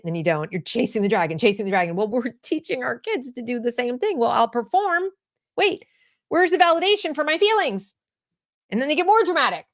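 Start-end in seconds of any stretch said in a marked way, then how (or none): 4.2-7.9 put-on high, whiny kid voice demanding validation; a hammed-up teen fishing for sympathy; mock needy kid. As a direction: play the attention-hungry kid, pitched up. Mocking a child performing feelings.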